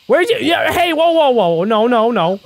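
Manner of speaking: startled. Real, not acted. Sudden laughing gas chaos.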